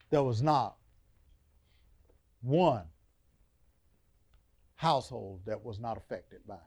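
normal talking voice

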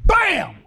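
loud shouted bam; imitated smack. Preacher acting out grandmother's whack, shouted. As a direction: shout the sound of a slap.

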